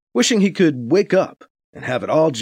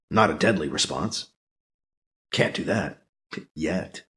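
low, soft menacing thought; ominous low aside; 9 semitones lower, 6 dB quieter than usual. Acted. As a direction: let the last word hang darkly. Dark private thought.

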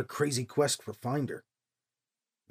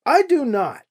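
mad; 8 semitones higher, 5 dB louder than usual. A narrator's reading. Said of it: "She went from fuming to mad".

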